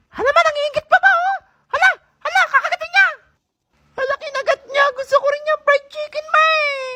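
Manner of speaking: put-on high kid voice tugging at mom; high child voice; envious child. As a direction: whine to mom for food.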